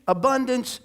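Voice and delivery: normal talking voice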